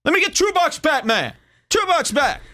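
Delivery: annoyed customer. Pretend. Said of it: "you'd say".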